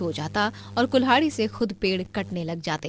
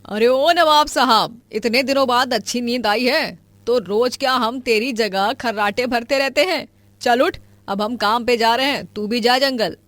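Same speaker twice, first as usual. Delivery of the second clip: scolding mother; 3 semitones higher, 5 dB louder than usual. Acted. Mother rousing her lazy son from bed, loud scolding.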